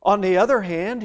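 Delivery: ordinary delivery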